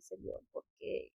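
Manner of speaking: soft, slow, broken-hearted plea to God; a hushed, crying question; tearful plea. Retold or imitated. Praying through tears, asks the Lord why. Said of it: "Yo empiezo a llorar y decir".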